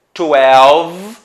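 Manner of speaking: twelve stretched out loud, closing on v; "twelve" projected and held to the final v; ending on V. Shown as a demonstration. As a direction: say "twelve" with no vowel after the v. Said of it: "o som termina no V e não no".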